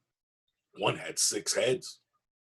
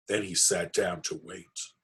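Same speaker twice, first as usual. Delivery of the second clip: usual speaking voice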